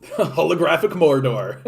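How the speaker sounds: words breaking into laughter; amused laughing. Real, not acted. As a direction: crack up while reading out a silly joke. Laughs at a joke on word.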